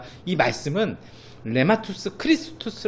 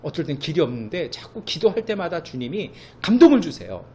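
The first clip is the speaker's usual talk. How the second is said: usual speaking voice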